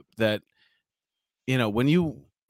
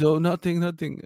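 higher-pitched, lightly put-on Mexican accent; 5 semitones higher than usual. Scared restaurant guy answering a cop, imitated Mexican accent. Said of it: "That was my Mexican accent".

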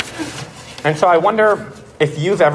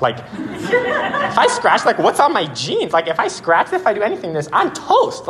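high-pitched mock panic; comic panic; 8 semitones higher than usual. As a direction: retell it as an exaggerated, squeaky fret. Retold panic over scratching costly car.

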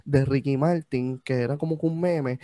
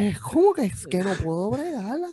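exasperated; 5 semitones higher than usual. Real, not acted. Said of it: "exasperated rant".

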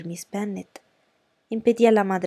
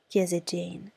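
ordinary delivery

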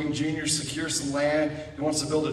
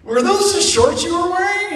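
high, louder acted disbelief; incredulous mock question; 12 semitones higher, 6 dB louder than usual. Someone at camp looks at Dusty, high-pitched disbelief. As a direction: squeal the question, scandalized.

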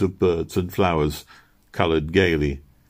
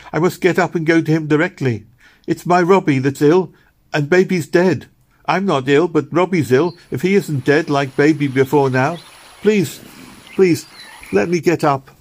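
high, louder desperate begging; desperate pleading; 9 semitones higher, 5 dB louder than usual. A narrator's reading. Meg begs Missus Blossom to let her up, desperate pleading. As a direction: beg them not to keep her.

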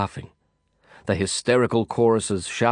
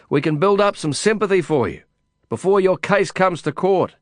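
lightly voiced, raised and eager; eager urging; 8 semitones higher, 4 dB louder than usual. A narrator's reading. Lin urges his jailed friend to take help, raised eager voice. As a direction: press the good news on him over the noise.

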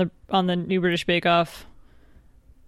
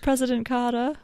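mock Southern accent; 5 semitones higher than usual. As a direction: say "Carter" as a Southerner would, without r's, pitched higher. Playful Carter drawl, r dropped.

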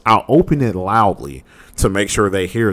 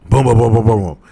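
rapid-fire vocal booms; imitated door banging; 5 dB louder than usual. Storyteller imitating banging on the door at night, rapid booms. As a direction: mimic a fist pounding on a door. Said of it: "She banging on the door".

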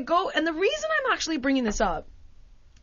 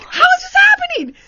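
disbelief; 9 dB louder than usual. Retold or imitated. Disbelief at clerk fleeing.

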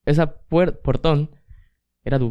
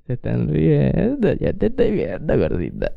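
put-on slow, drawn-out drunk singsong; imitated drunk son cooing at mom; drunk teasing; slower than usual. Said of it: "Así le dije a mi mamá".

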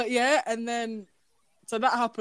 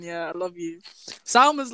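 ordinary talk, nothing marked

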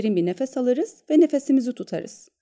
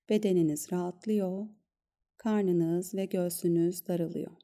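soft, calm guided meditation voice; soft spa whisper; 5 dB quieter than usual. Acted. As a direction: gently guide them into stillness. Leads a breath-awareness meditation.

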